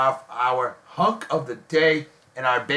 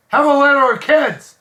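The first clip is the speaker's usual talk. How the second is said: put-on "Have a litter of kids" called out much higher and louder, with "a" stressed; hammed-up proclamation; 12 semitones higher, 9 dB louder than usual. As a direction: proclaim it like a mock doctor's orders. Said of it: "That's a Doctor Baker Love doctor thing".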